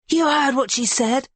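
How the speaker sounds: high, commanding shout. A narrator's reading. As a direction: shout at the men. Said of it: "Amara shouts".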